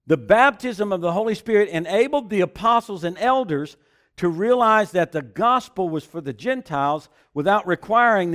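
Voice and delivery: loud, high preaching with building fervour; full-force preaching. Acted. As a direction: preach it out to the congregation.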